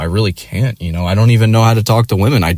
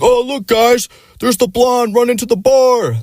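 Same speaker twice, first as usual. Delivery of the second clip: much higher, hyped-up crowd-work voice; hammed-up emcee. The owner hyping the bar crowd, mocked high and cheesy. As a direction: imitate a cheesy bar comic working the room.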